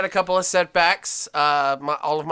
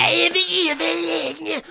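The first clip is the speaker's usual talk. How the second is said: higher hissing villainess impression; cartoon villainess voice; 11 semitones higher than usual. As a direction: hiss the name like a sorceress. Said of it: "a hissing voice or something".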